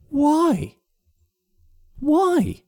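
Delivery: high, incredulous repeated whys; rounding on his partner, unable to believe it; baffled disbelief. Retold or imitated. Retelling his reply to his wife, high incredulous disbelief. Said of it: "And I was just like".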